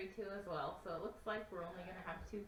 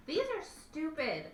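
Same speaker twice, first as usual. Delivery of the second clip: frustrated; 9 semitones higher, 7 dB louder, slower than usual. Real, not acted. Frustrated by heavy coins.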